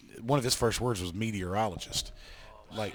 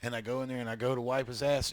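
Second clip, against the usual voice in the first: ordinary delivery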